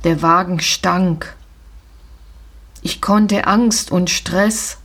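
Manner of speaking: plain reading voice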